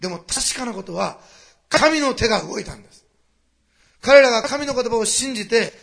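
loud, high, slow climactic preaching; preaching at full force. Acted. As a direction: build to a triumphant proclamation. (全力で叫ぶ説教)

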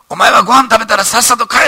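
high, loud scolding bark at Ito; boss shouting. Retold or imitated. Company president shouting at young Ito at the banquet, loud and high. Boss ordering him home.

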